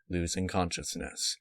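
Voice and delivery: ordinary delivery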